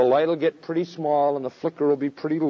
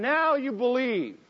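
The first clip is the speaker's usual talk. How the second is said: voicing Jesus; 9 semitones higher, slower than usual. Jesus voiced a third time.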